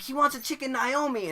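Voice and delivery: comically strained, loud repeat; loud relayed order. Retold or imitated. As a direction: shout the order to the cashier. Relaying misheard order to cashier.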